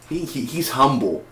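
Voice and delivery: ordinary delivery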